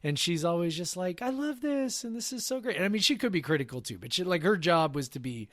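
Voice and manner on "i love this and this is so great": high, bubbly gushing judge voice; sugary-sweet TV judge, pitched way up; bright perky judge. Retold or imitated. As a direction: gush like the perky cupcake judge. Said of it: "the bright and perky cupcake person".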